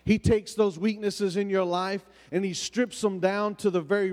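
rising, fervent preacher cadence; preaching. Acted. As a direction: preach it, building in pitch. Preaching build-up on the blood.

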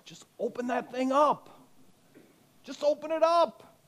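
high squeaky mocking voice; mock silly voice. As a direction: parody a naive believer, squeaky. Mocking flipping Bible for answers.

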